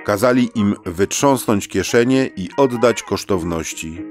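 ordinary delivery, nothing marked

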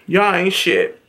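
ordinary talk

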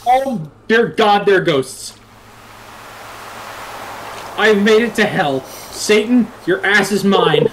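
excited gamer. Acted. Player reaching hell in game.

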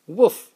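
one high, loud yappy woof; playful puppy woof; dog bark. Acted. Dad barks for the dog.

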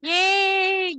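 ordinary delivery

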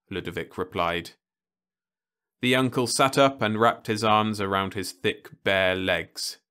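plain reading voice

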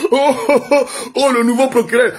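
high, jeering oh-oh; teasing, high-pitched hoots into a jibe; mocking. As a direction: imitate inmates making fun of someone. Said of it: "tout le monde s'est moqué".

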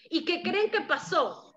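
ordinary delivery, nothing marked

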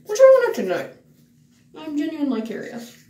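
usual speaking voice

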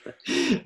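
short high-pitched laugh; real amused laugh. Real, not acted. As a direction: let out a quick high laugh. Laughs at the joke.

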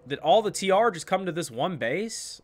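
normal talking voice

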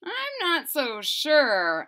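plain reading voice

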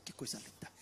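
awed whisper. Acted. Barnabé admires the church, whispering in awe. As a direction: whisper que coisa linda in wonder. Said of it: "Barnabé chegou e disse".

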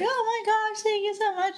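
high, girlish squeal of thanks, acted; excited gratitude. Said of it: "And she's so excited".

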